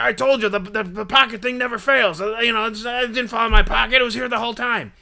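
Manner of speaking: high, loud friend imitation; hammed-up indignant self-defense; in-your-face insisting. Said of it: "he gets in my face".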